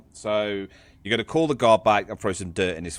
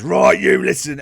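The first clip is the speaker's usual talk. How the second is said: loud, raised-pitch telling-off; guard's stern order; 5 semitones higher, 11 dB louder than usual. Acted. Guard running over to the hole, loud stern warning. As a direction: start a loud warning to a prisoner. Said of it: "runs over and goes".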